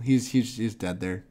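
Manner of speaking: normal talking voice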